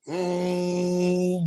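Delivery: long, steady imitated engine drone; scooter motor hum.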